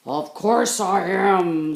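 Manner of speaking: slow, very loud burly voice; big bear voice. Acted. Grizzly Bun booming that she is a bear. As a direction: plant your feet and boom.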